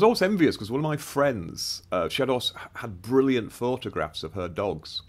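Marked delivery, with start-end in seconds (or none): none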